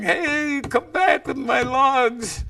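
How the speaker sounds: high, held-back calling out; mock yell. Boy on the bank to coyote stealing his logs, high mock yell. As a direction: call after a thief in a high voice, without real volume. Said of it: "he started yelling".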